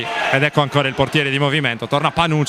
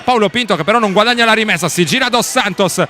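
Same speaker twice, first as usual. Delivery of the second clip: excited live commentary; 6 semitones higher than usual. Real, not acted. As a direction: build the tension, faster. Frantic final-seconds live commentary.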